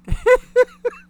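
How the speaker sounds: loud, high bark of laughter. Real, not acted. Host cracking up at chat, loud laugh. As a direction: burst out laughing. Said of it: "Reason why I'm laughing".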